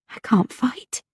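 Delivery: scared, breathless whisper; a frightened whispered thought. A narrator's reading. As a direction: whisper it, afraid to admit it. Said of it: "Patience was suddenly frightened".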